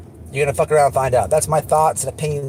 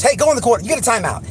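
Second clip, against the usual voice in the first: put-on scolding a toddler; 7 semitones higher, faster than usual. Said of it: "for, like, a terrible twos".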